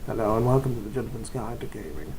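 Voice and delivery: put-on quick, soft, low English accent; English accent impression. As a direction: imitate an English YouTube host, hushed. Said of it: "chick getting English accent right there".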